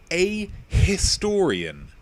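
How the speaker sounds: over-enunciate in the Queen's English. Shown as a demonstration. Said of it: "speaking the Queen's English".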